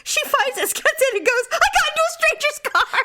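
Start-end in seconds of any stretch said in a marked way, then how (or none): 1.5-3.0 high-pitched mock mortified wail; hammed-up panicked confession; embarrassed, terrified. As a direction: imitate a mom blurting out her horror. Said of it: "with a severely embarrassed, terrified look".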